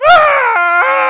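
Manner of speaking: high, very loud frightened wail; a panicked kid at the top of the slide; scared child's wail. Retold or imitated. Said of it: "and he goes".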